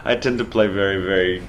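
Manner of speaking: talking through real laughter; laughing. Real, not acted. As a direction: laugh while getting the words out. Laughing at being asked to stop.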